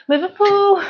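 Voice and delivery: high, loud mock Scouse; attempted Liverpool accent. Shown as a demonstration. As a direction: have a failed go at Scouse, loudly. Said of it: "Can't get the accent".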